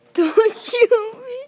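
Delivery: high, loud, drawn-out begging; pretend frightened plea. Soldier begging someone not to shoot, high slow plea. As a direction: plead slowly in play fear.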